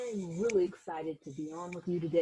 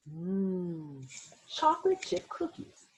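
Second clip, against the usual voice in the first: delighted mmm; slower than usual. Acted. Savoring cookies for kids.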